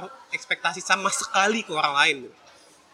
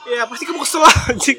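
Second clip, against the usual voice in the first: loud annoyed shout with a laugh; frustrated outburst; 5 semitones higher, 7 dB louder than usual. Real, not acted. Venting to viewers about parents, annoyed shout. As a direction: blurt out the frustration, half laughing.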